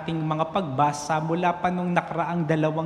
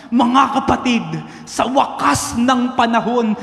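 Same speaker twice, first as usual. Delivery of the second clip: loud, high end-of-the-world sermon; fiery doomsday preaching; 5 semitones higher, 7 dB louder than usual. Retold or imitated. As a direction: thunder the apocalypse at the churchgoers. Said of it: "punong-puno ng emotions yung kanyang sinasabi". (maalab at malakas na pangangaral)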